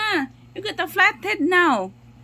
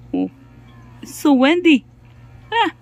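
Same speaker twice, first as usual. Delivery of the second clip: ordinary talk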